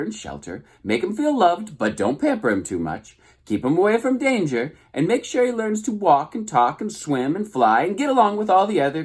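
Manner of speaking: high, breezy goose chatter. A narrator's reading. Goose explaining motherhood to the robot, high and breezy. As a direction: rattle it off like a know-it-all goose.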